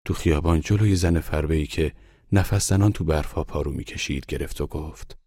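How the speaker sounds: ordinary delivery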